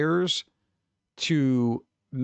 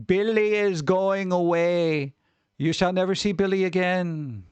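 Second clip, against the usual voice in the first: ominous announcement; 5 semitones higher than usual. Pretend. Teacher announces Billy's expulsion.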